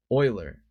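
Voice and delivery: Euler said OY-ler; corrected pronunciation. Shown as a demonstration. Card game commentator catching the slip, Euler corrected to OY-ler. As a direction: open Euler with "oy" as in boy. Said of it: "That's how you say that".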